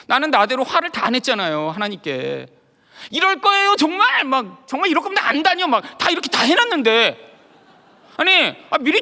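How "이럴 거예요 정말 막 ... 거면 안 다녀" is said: angry outburst. Retold or imitated. Venting anger at God.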